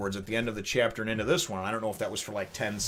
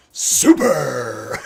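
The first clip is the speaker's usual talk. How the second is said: shout; 2 semitones higher, 11 dB louder than usual. Retold or imitated. Franky catchphrase shout.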